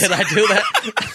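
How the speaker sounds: high, slow, laughing innocent voice; mock innocent. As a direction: play dumb with a giggle.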